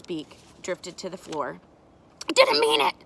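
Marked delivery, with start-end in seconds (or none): 2.3-2.9 high, loud outburst; shouted protest. A narrator's reading. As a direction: yell it like a panicked kid. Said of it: "she shouted".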